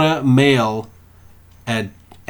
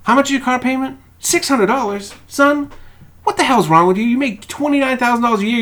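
scolding radio host; 11 semitones higher than usual. Retold or imitated. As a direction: chew out the caller like a no-nonsense money host. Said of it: "And he goes".

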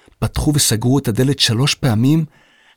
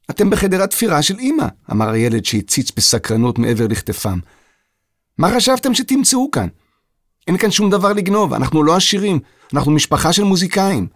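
put-on a boy's higher voice, puzzled and proud; young Mozart, higher and bright; curious young boy; 8 semitones higher than usual. A narrator's reading. Boy answers the intruders. (ילד סקרן בקול גבוה)

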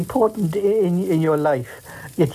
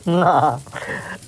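breaking character giggling. Real, not acted. Giggling again during the scene.

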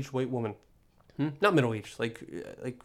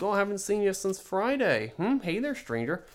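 higher, sing-song mock greeting, dripping with irony; ironic greeting; 8 semitones higher than usual. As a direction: tease them like a cheesy friend. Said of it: "I, like, ironically".

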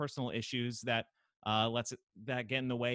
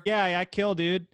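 mock boast; 10 semitones higher, 11 dB louder than usual. Joking answer to murderer question.

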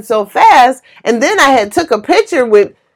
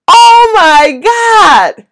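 stunned gasp; 9 semitones higher, 6 dB louder, much slower than usual. Real, not acted. Stunned that a stranger pays.

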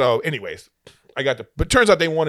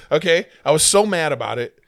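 ordinary talk, nothing marked

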